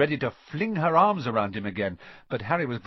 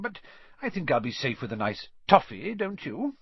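put-on whimsical old headmaster, pitched higher; Dumbledore; 6 semitones higher than usual. A narrator's reading. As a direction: muse with pleased, lively swoops.

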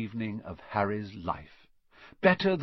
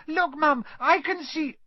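put-on much higher, louder child's excited cry; excited little girl; 4 dB louder than usual. A narrator's reading. Ginny spots Harry and cries out to Mum, excited. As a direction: point and shout like a thrilled little girl.

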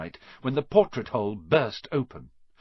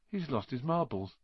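softer, under the breath to a friend; muttered aside; 4 semitones higher, 6 dB quieter than usual. A narrator's reading. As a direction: grumble it quietly aside. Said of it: "Ron muttered in Harry's ear".

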